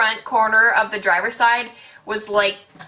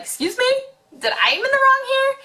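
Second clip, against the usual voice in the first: very high-pitched mock disbelief; incredulous indignation. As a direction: imitate an affronted boyfriend, voice shooting way up. Acting boyfriend's disbelief at lady.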